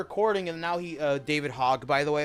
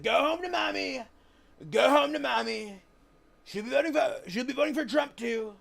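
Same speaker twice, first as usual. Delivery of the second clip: imitating Trump; 9 semitones higher than usual. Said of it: "He's like".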